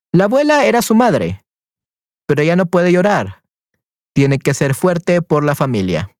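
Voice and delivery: gentle, clear storybook narration; story reading. Acted. Teacher reading the mother's part of the story to Esther, gently. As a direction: read it warmly, like to a child.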